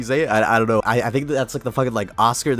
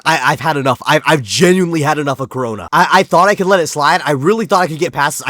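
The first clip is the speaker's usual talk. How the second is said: loud, fast exasperated outburst; fed-up rant; 5 dB louder than usual. Pretend. Reader who's had enough of Corona, mock fed-up rant. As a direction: rattle it out, at the end of your patience.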